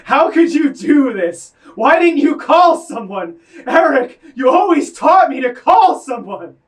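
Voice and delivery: high, loud furious shout at a dead friend; unleashed rage. Acted. Speaker finally shouting at his dead friend Eric, furious. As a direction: let all the held-in rage explode. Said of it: "unleash all the rage I've been holding in".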